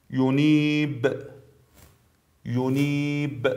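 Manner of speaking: ینیب with long "ii" and bounced final ب; brief qalqala. Shown as a demonstration. Tajweed teacher shows letter ب, final ب echoed in ینیب. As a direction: hold the vowel in ینیب, then pop the ب lightly. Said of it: "حرف با".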